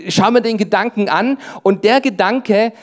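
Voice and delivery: ordinary talk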